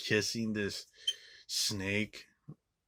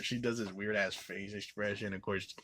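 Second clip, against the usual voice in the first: ordinary talk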